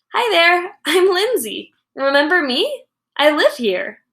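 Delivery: put-on girl greeting her own inner voice; playful hello. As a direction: greet yourself like a stranger.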